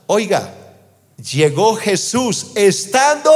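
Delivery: drawn-out, ringing preacher delivery; impassioned scripture reading. Acted. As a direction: preach the verse, stretching key words. Preaches scripture at full force.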